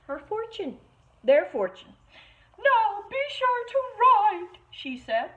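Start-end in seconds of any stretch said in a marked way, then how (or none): 2.6-4.6 high, loving mama pig voice; a mother pig fussing over her children; fond mama sow, pitched high. Acted. Mama pig's parting words.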